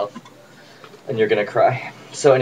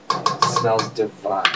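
savoring; 3 semitones lower, much slower than usual. Real, not acted. Savoring, slow.

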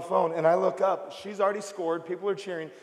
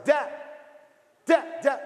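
put-on child yelling dad; 5 semitones higher, slower than usual. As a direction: yell it like a kid trying to get his father's attention. Child heard calling at airport.

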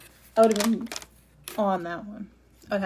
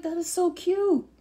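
much higher, slow, cooing delight; gushing so cute; 10 semitones higher, 3 dB louder, slower than usual. Retold or imitated. Reacting to middle school street graduations, high slow coo. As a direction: coo over it like a baby photo. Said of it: "And I'm like".

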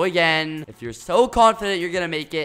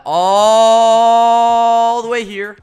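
slow and stretched, louder; drawn-out; 5 semitones higher, 11 dB louder, much slower than usual. Real, not acted. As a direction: drag every word out like the flight. Showing the flight in game.

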